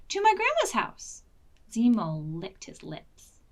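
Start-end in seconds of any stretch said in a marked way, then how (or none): 0.1-1.2 put-on child's reply. Betsy, high child voice.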